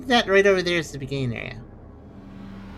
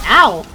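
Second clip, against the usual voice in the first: loud, abrupt yelp of "ow"; sudden "ow"; 10 dB louder than usual. Real, not acted. Player stabbed by an enemy while taunting it, startled ow. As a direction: yelp as if struck mid-sentence.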